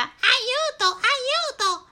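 molto più acuto e forte, spaventato; grido d'aiuto. Acted. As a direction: urla aiuto in preda alla paura. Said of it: "Grida Rossella". (Rossella grida aiuto al drago)

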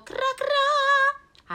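molto acuta e lenta, cantilenata; rana che gracida. Acted. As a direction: canta cra cra come una rana. La rana canta. (gracidio cantato di rana)

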